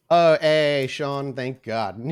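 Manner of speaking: ordinary talk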